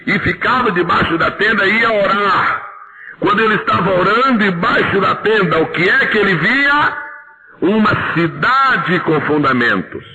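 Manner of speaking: high, slow fervent sermon; fervent preaching. Acted. Preacher paints Abraham's vision for the church, fervently. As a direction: proclaim it with rising fervor.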